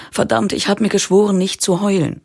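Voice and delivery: a touch of tearful upset, trying to regain composure; stammelnd, den Tränen nahe. A narrator's reading. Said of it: "stammelt sie und versucht, sich wieder zu fassen". (leicht weinerlich, um Fassung ringend)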